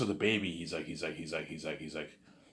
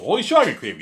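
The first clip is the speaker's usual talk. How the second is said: much higher and louder, thrilled with himself; excited outburst; 9 semitones higher, 11 dB louder, faster than usual. Real, not acted. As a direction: blurt it out, delighted at his own idea. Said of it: "I wanna tell a good story".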